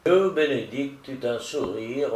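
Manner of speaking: ordinary delivery, nothing marked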